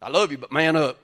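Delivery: teasing tough-guy impression; gruff mock Randall. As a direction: bark it like a tough mentor. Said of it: "Randall House would be like".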